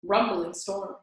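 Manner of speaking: ordinary delivery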